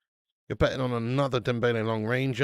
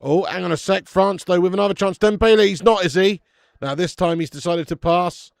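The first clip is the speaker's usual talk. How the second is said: higher and much louder; loud live reaction to a chance; excited commentary; 5 semitones higher, 9 dB louder than usual. Real, not acted. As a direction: call the attack as it happens.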